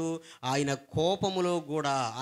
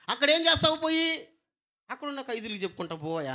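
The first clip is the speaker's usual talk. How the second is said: retold pastor; 8 semitones higher, 3 dB louder, faster than usual. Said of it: "అన్నాడంట".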